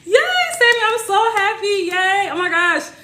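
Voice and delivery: high, happy cheering; cheering a viewer on with real joy; excited cheer. Real, not acted. Host cheering Sammy's testimonial, high and excited. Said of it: "I literally, like, scream".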